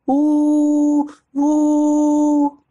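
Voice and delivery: hooting choo-choo whistle, high and loud; sing-song train hoot woo woo. A narrator's reading. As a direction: toot it like a toy train.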